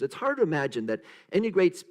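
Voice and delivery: usual speaking voice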